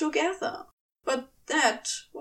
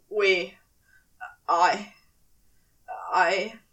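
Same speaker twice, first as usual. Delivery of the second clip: stammering; 4 semitones lower, 4 dB louder, slower than usual. Acted. Crowley stammering under pressure.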